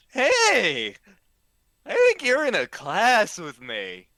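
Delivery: put-on loud, eager drunk classmate; drunk frat guy. Drunk frat guy accosts narrator.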